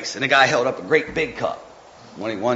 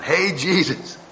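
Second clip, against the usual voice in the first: higher, excited call; shouting; 7 semitones higher, much slower than usual. Retold or imitated. Mom in heaven calling out to Jesus, joyful. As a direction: hail Jesus like an old friend. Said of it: "I bet she's shouting".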